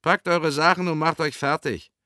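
loud, high-pitched command; barked order. Acted. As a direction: hurry the boys along sharply. Man orders roused boys to pack.

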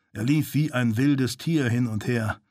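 ordinary delivery, nothing marked

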